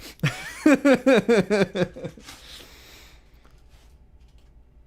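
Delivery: loud high laughing fit; loud laughter. Real, not acted. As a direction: crack up loudly. Keeps laughing.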